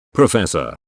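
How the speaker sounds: ordinary talk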